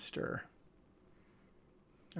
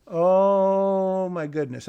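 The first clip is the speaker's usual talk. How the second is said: loud higher oh, then settling; amazed exclaim; 4 semitones higher, 9 dB louder, much slower than usual. Real, not acted. Reviewer reacts in disbelief to the script. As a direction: gasp it out in disbelief.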